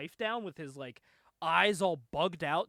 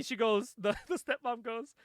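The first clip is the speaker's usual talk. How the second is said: ordinary delivery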